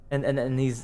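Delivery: normal talking voice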